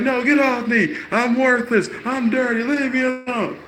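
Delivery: very high, loud imitated outcry; drunk guy despairing. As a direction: wail it like a drunk who feels worthless. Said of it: "Drunk guy's like".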